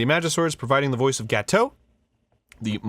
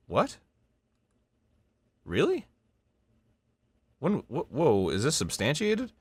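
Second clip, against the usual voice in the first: surprised; 3 semitones higher than usual. Real, not acted. Shocked by what he reads.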